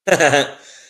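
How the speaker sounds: short, loud, high laugh; real burst of laughter. Real, not acted. Laughs while watching match.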